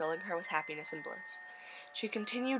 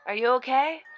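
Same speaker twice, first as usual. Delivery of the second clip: put-on boy's voice; 7 semitones higher, 8 dB louder than usual. Boy comforting crying girl.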